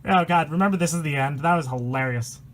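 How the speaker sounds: ordinary delivery, nothing marked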